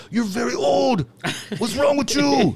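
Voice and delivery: high, loud imitated Ecuadorian accent; mock the sergeant's Ecuadorian accent, incredulous. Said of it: "he had a real thick, uh, Ecuadorian accent".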